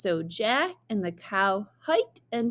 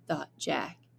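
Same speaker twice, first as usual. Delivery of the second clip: ordinary delivery, nothing marked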